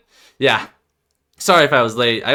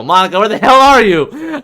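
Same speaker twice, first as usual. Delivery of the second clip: loud, high frustrated call; 10 semitones higher, 11 dB louder than usual. Acted. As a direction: shout across the room for her. Character calling for absent Monica.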